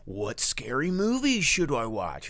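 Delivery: drawn-out, lightly put-on asking voice; imitated movie fans. Host lightly imitating people asking for recommendations, slow and high. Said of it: "people this month have been asking me".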